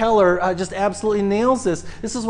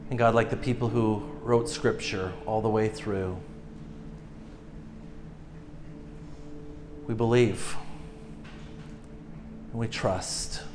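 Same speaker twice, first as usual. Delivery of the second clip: hushed heartfelt prayer; pastoral prayer; 9 semitones lower, 11 dB quieter than usual. Acted. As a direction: pray gently over the room. Closing prayer.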